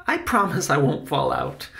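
lightly voiced boy, grinning; a boy's grinning promise, lightly acted; promising child. Voices Benny answering Salvador.

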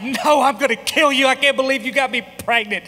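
wife sobbing that she'll kill him, hammed-up; mock sobbing threat. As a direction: voice the wife's tearful fury, half played for laughs. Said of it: "but she's like".

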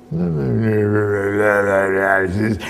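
low, very slow garbled drone; slurred distortion. Acted. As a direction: let the words melt into monstrous mush. Saying degrades into a monstrous thing.

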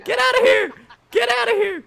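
high, cracking yells of disbelief. Real, not acted. Player watching the ball go wrong, voice-cracking yells. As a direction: yell high till the voice cracks. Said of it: "My voice is probably cracking".

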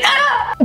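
high-pitched comic scream; short mock scream. Shown as a demonstration. Comedian demonstrating which screams to use when someone knocks, high mock scream. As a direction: let out a short, high yelp. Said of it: "you scream. But only certain screams. Screams like".

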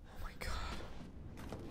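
barely whispered, very slow; hushed dread. Real, not acted. Player alone in a horror game, whispering in dread. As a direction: whisper it under your breath.

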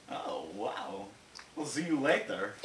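usual speaking voice

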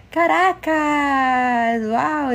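wowed, long-held cry of a place name; excited exclamation. Real, not acted. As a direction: stretch it out slowly, loud and high, thrilled. Amazed at viewer's city Caracas.

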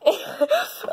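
short high-pitched laugh; quick laugh up high. Real, not acted. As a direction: burst into a brief, high laugh. Sees own look in sunglasses.

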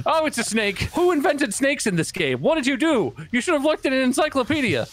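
high-pitched, incredulous rant; comic outrage. Real, not acted. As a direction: rant up high at whoever made this. Outraged at badly made game snake.

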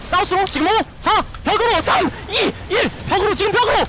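high urgent countdown; hyped shotcall. Real, not acted. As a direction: call the engage, high and driving. Countdown to engage. (흥분한 카운트다운)

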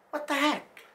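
usual speaking voice

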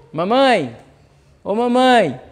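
criança chamando a mãe, meio chorosa; meio chorando. Retold or imitated. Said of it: "meio chorando". (filho chama a mamãe meio chorando)

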